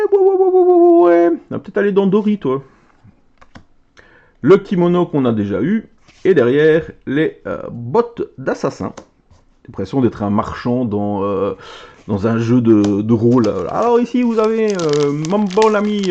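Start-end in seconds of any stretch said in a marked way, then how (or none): 0.1-1.3 rapid, much higher, louder string of ouais; excited yelps. Real, not acted. Card opener thrilled at a pull, excited squeals. 13.7-16.1 put-on playful Marseille accent, higher. Playing a shop merchant for viewers, Marseille accent. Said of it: "je prends l'accent marseillais".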